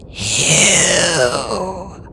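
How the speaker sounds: eerie, rough, breathy dragon voice; creaky rasp. A narrator's reading. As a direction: ancient creature's creaking hiss. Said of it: "she rasped suddenly".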